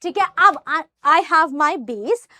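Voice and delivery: usual speaking voice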